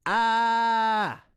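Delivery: high, loud open "ah" from the belly; diaphragm push "ah". Shown as a demonstration. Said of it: "get that feeling in your head of".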